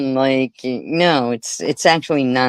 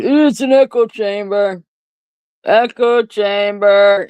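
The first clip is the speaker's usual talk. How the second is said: mocking voice; 8 semitones higher, 4 dB louder than usual. Mocking people who say echo chamber.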